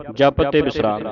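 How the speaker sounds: usual speaking voice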